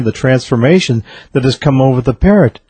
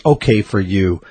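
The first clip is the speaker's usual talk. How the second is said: usual speaking voice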